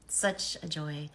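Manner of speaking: ordinary talk, nothing marked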